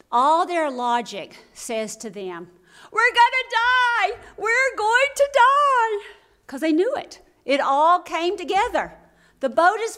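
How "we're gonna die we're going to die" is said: high, loud, slow cry of terror; panicked cry. Retold or imitated. Disciples in the sinking boat, panicked loud cry. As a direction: cry out like men sure they'll drown. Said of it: "they are in full panic".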